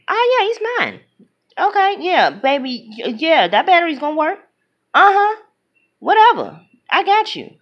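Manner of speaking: high mock side chick. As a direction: pitch way up, playful and possessive.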